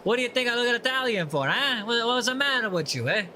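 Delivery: hammed-up Italian guy, eh; Italian accent. Viewer who thinks he looks Italian, in mock Italian accent. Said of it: "He's like".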